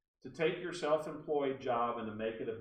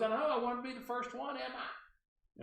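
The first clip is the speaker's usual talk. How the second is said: much higher mimicked accent; Barbara Delano; 12 semitones higher than usual. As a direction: mimic the caller's accent in a high-pitched voice. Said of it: "I have to use her accent".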